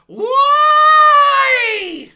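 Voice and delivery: long, very high screamed wail of a little droid; drawn-out piercing shriek; screaming. Acted. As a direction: scream it out at the top of your voice. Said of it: "It's just screaming the whole time".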